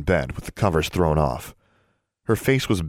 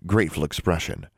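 ordinary delivery

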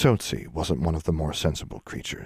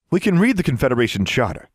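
higher, pushy official; Mister Johnson's voice; 5 semitones higher, 4 dB louder, faster than usual. Acted. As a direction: press the point smugly.

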